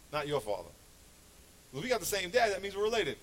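ordinary talk, nothing marked

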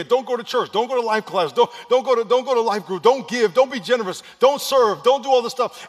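loud, high, rapid-fire barrage of orders; devil screaming. Acted. Devil screaming in a believer's ear, loud and insistent. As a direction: yell each command in their ear as the devil. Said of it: "the devil's screaming in your ear".

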